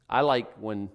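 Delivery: normal talking voice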